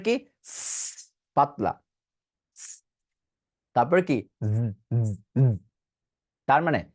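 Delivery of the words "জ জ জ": জ with a low vibrating buzz; voiced z. Shown as a demonstration. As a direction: buzz the z against the s. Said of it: "এটা হবে বাতাস". (গলা কাঁপিয়ে জ)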